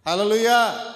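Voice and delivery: hallelujah called out to regain attention; loud call. Real, not acted. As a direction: call the room back to order. Calling the congregation back to order. (గట్టిగా హల్లెలూయా పిలుపు)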